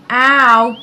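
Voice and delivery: sudden loud yelp of pain; pained cry. A narrator's reading. Guinea pig hurting his paw, loud ow. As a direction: cry out as the thorn bites. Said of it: "cried Bertie".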